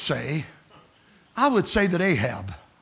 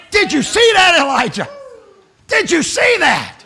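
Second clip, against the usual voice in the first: loud, higher cry of wonder; amazed exclamation; 12 semitones higher, 6 dB louder than usual. Acted. God exclaiming to Elijah in loud wonder. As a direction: call it out in delight.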